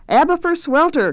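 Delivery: high, loud chorus answer; high-pitched mock scream. A narrator's reading. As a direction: shriek the name back eagerly. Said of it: "came the scream".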